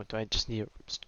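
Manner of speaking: ordinary talk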